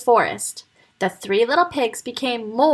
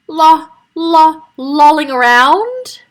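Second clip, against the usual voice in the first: mocking stammer; 6 semitones higher, 8 dB louder, slower than usual. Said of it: "stammered Freddie".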